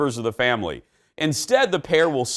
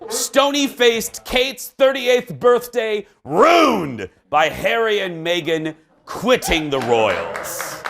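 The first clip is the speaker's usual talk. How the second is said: hammed-up British accent, higher and slow; 6 semitones higher, slower than usual. Host reading tabloid headline in a hammed-up British accent. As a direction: announce it like a pompous British tabloid. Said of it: "That was a nice accent".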